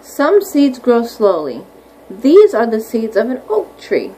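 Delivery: unhurried, clear picture-book reading; slow read-aloud. Acted. Teacher reading about oak trees to her class, slow read-aloud. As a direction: draw the words out for little listeners.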